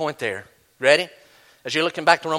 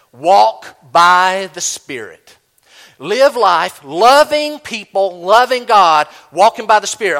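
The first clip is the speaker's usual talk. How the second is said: louder and slow, each phrase landed hard; slow, emphatic takeaway; 7 dB louder, slower than usual. Shown as a demonstration. Preacher hands the congregation the takeaway, slow and louder. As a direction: hammer home the takeaway, word by word. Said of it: "what's the takeaway today?".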